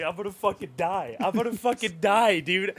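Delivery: building, rising retold lament; mock despair. Viewer overwhelmed by the film, mildly hammed-up rising despair.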